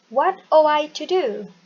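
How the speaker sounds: no G, H or T in "ought"; silent GH T. Shown as a demonstration. Teacher showing the class how to read ought. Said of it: "tidak perlu dibaca G H T-nya".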